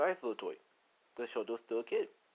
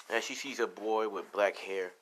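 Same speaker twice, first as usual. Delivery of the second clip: usual speaking voice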